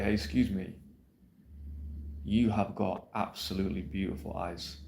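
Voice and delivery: ordinary delivery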